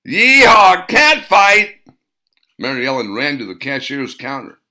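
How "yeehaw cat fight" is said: loud, high whooping yell; a trucker whooping at a brewing fight; trucker holler. A narrator's reading. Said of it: "Some trucker hollered".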